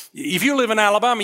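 normal talking voice

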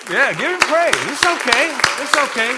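loud, high-pitched call for praise; rally the room at full voice; exhorting the congregation. Real, not acted. Urging congregation to give praise.

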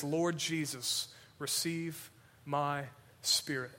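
quiet, slow last prayer; hushed dying prayer. Acted. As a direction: pray it gently and slowly, near the end. Dying martyr's prayer quoted.

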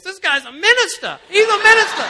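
very high, loud, laughing announcement; excited announcing. Retold or imitated. Tipsy women telling other tables about the minister, excited shout. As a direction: broadcast it gleefully to the whole room. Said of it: "they just got so excited".

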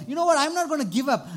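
loud, high, driving declaration; fired-up vow. Retold or imitated. Beggar resolving to chase Jesus, loud and high. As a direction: preach it at full force. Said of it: "So what he said".

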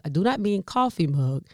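ordinary delivery

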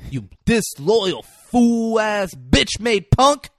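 imitated outrage, dragging out each insult; Denzel Washington. Acting out Denzel's betrayed outburst.

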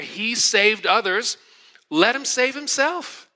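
high, slow sneering taunt; mocking crowd. Crowd mocks Jesus.

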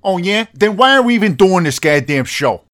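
fed-up outburst at a co-host; exasperated challenge. Acted. Heated argument over the show.

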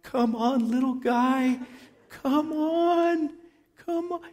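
high, pleading coax to a dying bird; a hint of a big roughneck gently coaxing; coaxing tender plea. Roughneck coaxing a dying hummingbird, high and pleading. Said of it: "He's like".

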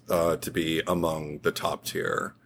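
low creaky vocal fry, slowly. Shown as a demonstration. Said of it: "So I was trying to do a vocal fry there".

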